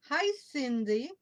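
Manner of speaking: ordinary talk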